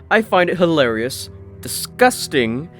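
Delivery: ordinary delivery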